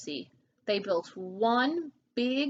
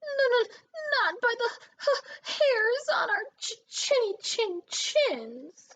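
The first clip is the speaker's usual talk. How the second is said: much higher, stammering and shaking; trembling; 12 semitones higher than usual. A narrator's reading. Frightened pig answering the wolf, trembling. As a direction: quaver every word in fear. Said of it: "trembled Boris".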